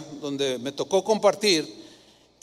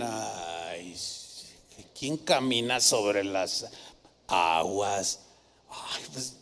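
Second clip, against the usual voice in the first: much lower, slow, sneering scoffer's voice; scoffing skeptic; 11 semitones lower, 8 dB quieter, much slower than usual. Retold or imitated. Pastor mimicking doubters who scoff at walking on water, low and slow. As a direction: sound like a know-it-all who laughs at miracles. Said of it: "los que explican todo dirían".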